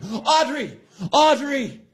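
high-pitched imitation of a scream; Russ screaming. Host imitating a coworker yelling across the restaurant, mock screaming. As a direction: call out across a crowded room, mock-frantic. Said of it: "we hear Russ screaming".

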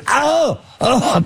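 high yelping oh oh; mock pained cry. Retelling the actress biting his arm, a high mock yelp. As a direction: yelp like you've just been bitten.

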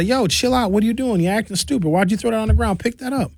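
a hint of an exasperated grown-up correcting a teen; scolding stranger. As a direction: tell the kid off like a stern adult in public. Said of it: "and say".

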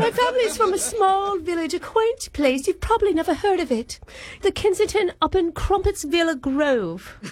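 high, hammed-up posh English accent; put-on British accent. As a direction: prank caller with a fake British lilt. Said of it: "English sounding".

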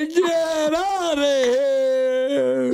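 imitating a caller's long holler; drawn-out, hammed-up echo of a yell; mock yell. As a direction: stretch every word out high, like a mock holler. Co-host mimics caller's long yell.

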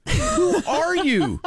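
high, laughing, mock-alarmed question. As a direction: ask it while breaking into a laugh. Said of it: "I was like".

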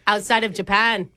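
laid-back Aussie voice; Australian accent. Acted. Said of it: "Are you from Australia?".

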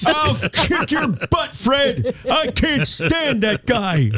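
put-on high, slow old man shouting; grandfather yelling. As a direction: yell like a fiery grandpa. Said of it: "my grandfather's like".

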